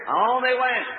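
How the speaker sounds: normal talking voice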